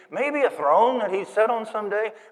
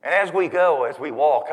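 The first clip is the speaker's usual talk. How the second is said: ordinary talk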